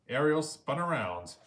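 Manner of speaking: ordinary delivery, nothing marked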